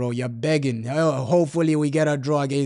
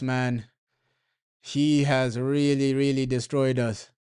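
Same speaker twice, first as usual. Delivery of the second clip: normal talking voice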